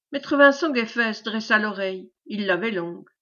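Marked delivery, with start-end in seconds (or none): none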